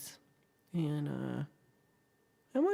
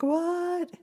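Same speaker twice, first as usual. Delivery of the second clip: very high, loud mock disbelief; mock shocked; 13 semitones higher, 6 dB louder than usual. Streamer reacts to imagined caller's street corner, mock shocked. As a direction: swoop up in pretend astonishment.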